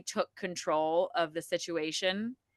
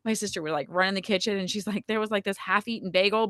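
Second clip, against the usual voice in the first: normal talking voice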